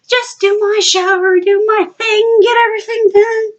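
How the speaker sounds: high, tense sing-along resolve; strained high mock determination; retold nervous self-talk. Retold self-talk ignoring clown heads.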